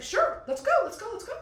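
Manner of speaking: high, eager let's go. Retold or imitated. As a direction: jump at the offer. Eager flirting voice.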